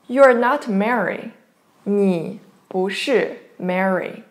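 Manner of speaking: ordinary talk, nothing marked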